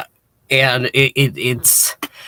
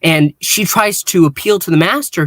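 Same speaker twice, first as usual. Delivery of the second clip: ordinary talk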